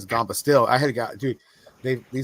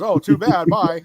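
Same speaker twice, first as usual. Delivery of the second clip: high, loud imitation of a dismissive lawyer; mock brush-off; 7 semitones higher, 10 dB louder, slower than usual. Lawyer brushing off losing client, mock dismissal. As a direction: toss off a dismissal, raised voice.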